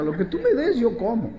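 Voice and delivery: put-on a kid promising to eat anything; childish voice. Child voice in mother's scolding memory.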